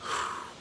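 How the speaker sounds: soft, weary sigh. Acted. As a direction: let out a quiet breath before confessing. Actor pauses before breakup confession.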